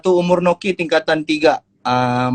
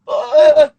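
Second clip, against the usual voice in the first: pained cries; 9 semitones higher, 7 dB louder than usual. Retold or imitated.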